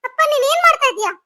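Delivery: high, urgent cries to stop; alarmed pleading. Acted. Daughter stopping father hurting mother, alarmed pleading.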